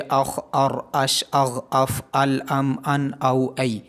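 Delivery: ordinary delivery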